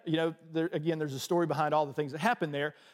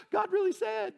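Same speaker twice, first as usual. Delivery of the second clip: much higher, amazed "God really said"; astonished. Retold or imitated. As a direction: squeak it out in happy disbelief. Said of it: "I'm like".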